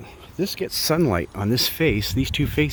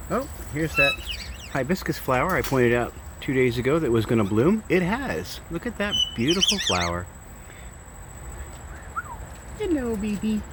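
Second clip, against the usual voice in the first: pet talk; 7 semitones higher than usual. Real, not acted. Cooing near birds and flower.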